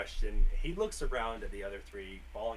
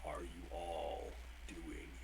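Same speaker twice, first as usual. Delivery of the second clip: slow creature voice; 5 semitones lower, 8 dB quieter, slower than usual. Acted. GM voices creature repeating question.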